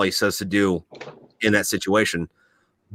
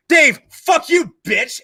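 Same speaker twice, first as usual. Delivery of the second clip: much higher, louder, slow hammed-up yell at Dave; mock angry neighbor; 5 dB louder, much slower than usual. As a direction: play the furious neighbor, over the top. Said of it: "then I'll come back up like".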